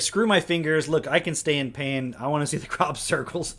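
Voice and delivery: loud, high, hammed-up insistence; mock determination. Mimicking her wanting crop circles despite pain, mock determination. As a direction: imitate a woman who won't be stopped, loud.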